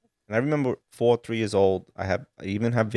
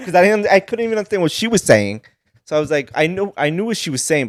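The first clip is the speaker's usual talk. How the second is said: laughing; 8 semitones higher, 5 dB louder than usual. Real, not acted.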